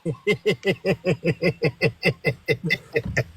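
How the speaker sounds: real, drawn-out laugh in steady bursts; long laugh. Real, not acted. Reacting to a friend's joke about Gazria, long laugh.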